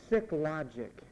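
usual speaking voice

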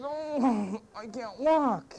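a hammed-up helpless plea; pitiful whine. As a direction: beg weakly like a lazy man caught out. Lazy man pleads with Jesus.

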